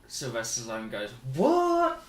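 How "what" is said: high, loud mock surprise; Stallone's joking surprise; joking what. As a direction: fake a shocked what. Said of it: "Sort of joking".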